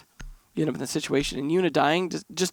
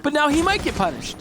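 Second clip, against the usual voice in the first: excited; 10 semitones higher than usual. Real, not acted. Excited play-by-play.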